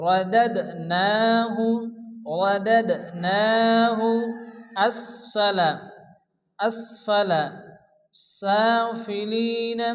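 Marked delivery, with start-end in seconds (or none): 0.1-4.0 رددناه very slow, louder, echoing the dal; qalqalah demo. Shown as a demonstration. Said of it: "হালকা ধাক্কা দিয়ে পড়তে হবে". 8.4-9.8 سافلين slow with the first a held one count; one-count stretch. Shown as a demonstration. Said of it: "এক আলিফ পরিমাণ টান".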